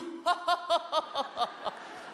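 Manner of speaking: high, rhythmic ha-ha-ha; long staged laugh trailing down; mock belly laugh. Colleague at the meeting laughs off Leloup, mock laughing.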